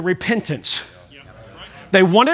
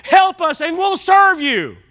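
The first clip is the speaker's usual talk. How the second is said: desperate cry to God; 7 semitones higher, slower than usual. Retold or imitated. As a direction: cry out for rescue in a panic. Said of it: "they cried out to God".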